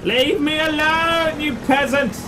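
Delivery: high, slow wailing plea; drawn-out high-pitched distress; panicked pleading. Real, not acted. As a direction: whine for help, drawing words out. Pleading with enemies and viewers.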